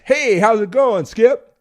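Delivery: big energetic radio caller; hearty shouted hello; loud caller greeting. Retold or imitated. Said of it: "I have some guys".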